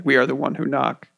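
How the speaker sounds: ordinary talk, nothing marked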